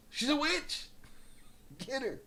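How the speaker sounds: high shrill cry of witch; accusing villager. Retold or imitated. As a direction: yell it like a witch-hunter. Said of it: "They'd call her a witch".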